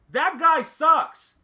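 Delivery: loud drawn-out put-down. Real, not acted. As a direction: stretch every word and lean in hard. Ranting about a bad player.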